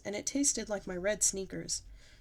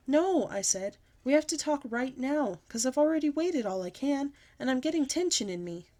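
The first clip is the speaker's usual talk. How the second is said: insistent; 5 semitones higher, 4 dB louder than usual. A narrator's reading. Child insisting to dad.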